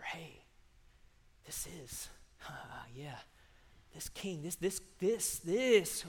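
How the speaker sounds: hushed, halting rumour building in volume. Acted. People spreading word about a new leader, building whisper. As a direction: start under your breath, then swell. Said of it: "doesn't take long for whispers to start becoming louder and louder".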